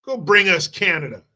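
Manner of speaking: egging Trump on like a crowd; lightly voiced crowd urging the president; American people demanding. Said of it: "the American people said".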